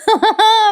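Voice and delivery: short high-pitched laugh; real quick amused giggle. Real, not acted. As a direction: laugh briefly after your punchline. Storyteller laughs after punchline.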